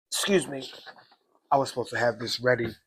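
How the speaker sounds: ordinary talk, nothing marked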